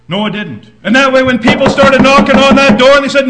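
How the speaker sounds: loud, driving preacher's voice; fiery preaching. Acted. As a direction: proclaim it to the church. Preaching at full force.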